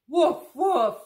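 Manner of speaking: loud playful puppy woofs; puppy barks. Acted. Being Biscuit the puppy for the kids, loud playful woofs. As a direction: bark like a happy little dog.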